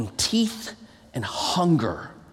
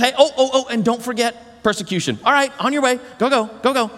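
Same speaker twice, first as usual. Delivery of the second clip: mock casual send-off; 6 semitones higher, faster than usual. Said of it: "he's not just".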